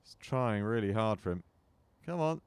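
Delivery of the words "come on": rising, louder urging; will the ball into the hole. Real, not acted.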